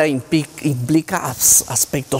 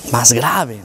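a lower pitch to show a sad, deeper voice; lower answer; 6 semitones lower than usual. Shown as a demonstration. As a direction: drop the pitch to demo the low voice. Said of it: "¿Más aguda o más grave?".

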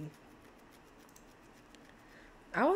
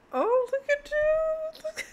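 cooing; 4 dB louder than usual. Real, not acted. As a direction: streamer cooing at the couple on screen. Cooing at cute Sims.

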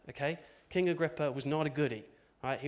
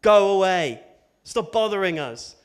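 loud, slow, sulky snapping at a stranger; mock annoyed brush-off; 5 semitones higher, 10 dB louder, slower than usual. Disciples snapping at the nosy stranger, loud and sulky. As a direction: shoo him off like a sulky teenager. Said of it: "Being like".

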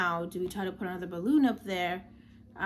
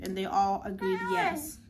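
normal talking voice

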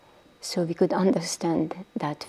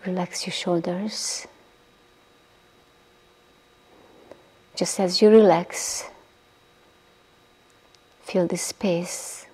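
guided meditation. Acted. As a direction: ease the listeners down into stillness, breathy and unhurried. Leads a guided body-relaxation meditation.

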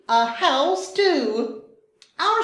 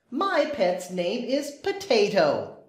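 usual speaking voice

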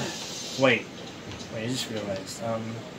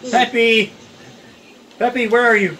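raised, higher searching call; 7 semitones higher, 5 dB louder than usual. Pretend. Trying to find Peppy, calling out loudly. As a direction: shout to someone out of sight.

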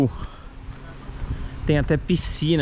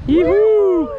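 high, loud whoop of joy; excited cheer; 8 dB louder than usual. Real, not acted. Cheering end of the trip.

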